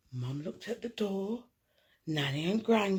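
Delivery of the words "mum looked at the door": ordinary delivery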